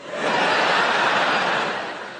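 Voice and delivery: high airy giggle in character; falsetto giggle. Retold or imitated. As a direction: keep the Michael squeal going. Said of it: "And he'd go".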